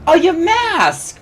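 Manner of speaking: high, slow delighted cry; light up at the find; excited gasp. Acted. Mia spots the Pokemon.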